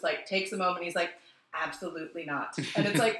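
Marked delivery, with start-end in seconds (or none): 1.5-2.5 quiet flat denial. Retold or imitated. Michael after taking a moment.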